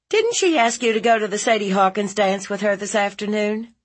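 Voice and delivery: high, quick leading question; cross-examine him briskly; interrogating aunt. Acted. Aunt grilling nephew about the dance, high and quick.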